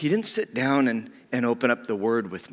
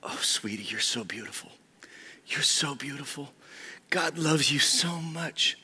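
tender hushed blessing; 4 semitones higher than usual. Retold or imitated. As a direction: coo it gently to a hurting girl. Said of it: "Charlene grabs her face and just goes".

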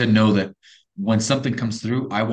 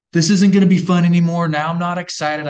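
put-on high, loud teen complaint; whining kids; 7 semitones higher, 7 dB louder than usual. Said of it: "all the kids are like".

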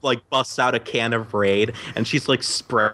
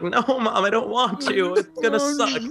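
put-on much higher, whining child voice; whiny kid; 11 semitones higher than usual. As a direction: whine like a little kid refusing. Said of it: "and I was like".

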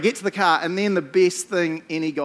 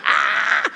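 whispered panicked gasp; 7 dB louder than usual. Retold or imitated. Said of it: "I'm like".

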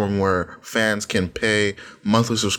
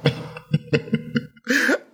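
high breathy giggle. Real, not acted. Laughing at own girl voice.

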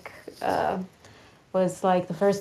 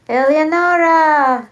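loud, drawn-out call, pitched high; 6 semitones higher, 8 dB louder than usual. Pretend. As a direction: call her name out as if across the house. Calling out for absent guest.

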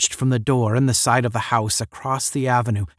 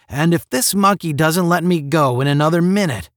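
louder, higher, fed-up threat; raised voice, losing patience; impatient threat; 6 semitones higher, 5 dB louder than usual. A narrator's reading. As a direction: build to an exasperated warning. Threat from impatient held-up man.

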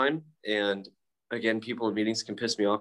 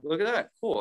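pleased surprise; 9 semitones higher than usual. Retold or imitated. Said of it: "I was like".

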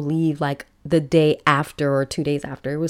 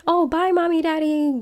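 put-on much higher, breezy kid voice saying bye; carefree kids; 12 semitones higher than usual. Kids waving off mom and dad's trip, high breezy voice. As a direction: toss it off lightly like an unbothered child.

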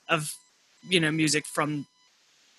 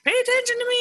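high-pitched mock yell of a little child; imitated screaming kid; faster than usual. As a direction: imitate a toddler demanding attention, pitched way up. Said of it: "screaming at him".

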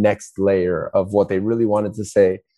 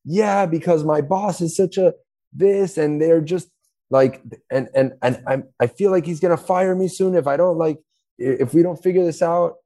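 stressed partner; 8 semitones higher than usual. Retold or imitated. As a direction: pour it out like you're worn out. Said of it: "partner venting about boss".

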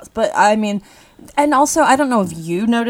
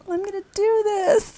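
eager mock exclaim; 13 semitones higher than usual. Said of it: "you were like".

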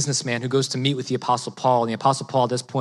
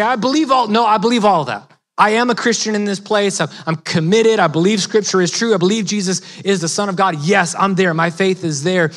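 insistent claim; 5 semitones higher, 4 dB louder than usual. Retold or imitated. Quoting believers' verbal confession.

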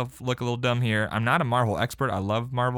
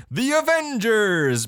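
high, loud, drawn-out battle cry; hammed-up heroic holler, stretched long; mock victory yell; 11 semitones higher, 5 dB louder, much slower than usual. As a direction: pretend to shout it out triumphantly. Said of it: "gonna wanna yell out".

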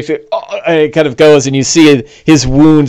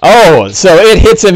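ordinary delivery

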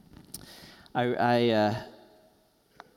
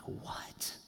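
shocked whisper; 12 dB quieter than usual. Retold or imitated. Shocked at friend's death news.

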